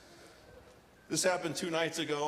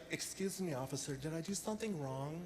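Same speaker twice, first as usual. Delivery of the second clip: soft, sweet and much quieter, playing innocent; mock-demure woman sweet-talking a cop; 8 dB quieter than usual. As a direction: coo it to the officer like butter wouldn't melt. Woman pulled over by cop.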